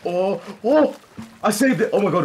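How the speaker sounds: high, loud cry of triumph; thrilled yelling; excited shout. Real, not acted. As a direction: yell the win to the team. Grabbing dropped Reaper flag.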